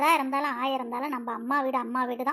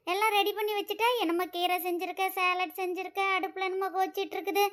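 ordinary delivery

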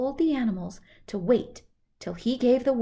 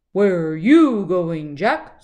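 loud, slow, lowered bull voice; snorting bull; 3 semitones lower, 10 dB louder, slower than usual. Acted. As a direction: rumble it slowly like a bull. Bull character speaking.

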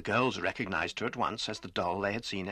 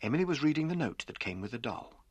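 ordinary delivery, nothing marked

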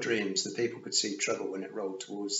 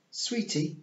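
high, cold and venomous; hissed; 11 semitones higher than usual. A narrator's reading. The Queen hisses at Tiffany, high and venomous. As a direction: spit the word back at her. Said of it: "she hissed".

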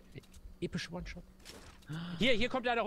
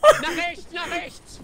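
high laughing yell of warning; grenade warning yell; 10 semitones higher, 12 dB louder, much slower than usual. Real, not acted. Yelling directions with a grenade flying. (lachender Warnschrei)

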